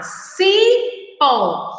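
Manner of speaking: "Cipó" with a long, high CI; read aloud clearly. Shown as a demonstration. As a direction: sound out "Cipó" as ci, pó. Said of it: "Vamos falar em voz alta".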